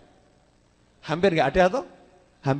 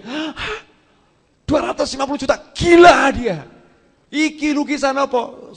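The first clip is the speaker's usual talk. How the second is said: shocked outburst; 8 semitones higher, 7 dB louder than usual. Retold or imitated. Orang kaget lihat harga lukisan.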